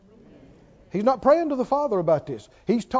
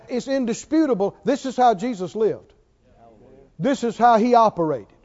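ordinary talk

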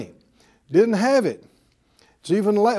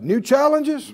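higher, emphatic call for repeating; out-loud declaration; 7 semitones higher than usual. Real, not acted. Preacher leads congregation to repeat after him, out-loud declaration. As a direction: lead the room in declaring it. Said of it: "Say it out loud".

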